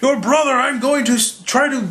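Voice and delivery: lightly voiced Thor pleading; mock Thor. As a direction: plead like a brother while swinging. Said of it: "he's like".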